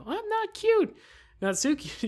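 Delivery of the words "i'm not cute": put-on much higher pouty girl denial. Pouty girl insisting to her friend she isn't cute. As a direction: squeak out the denial like a sulky girl. Natsuki voiced, denying being cute.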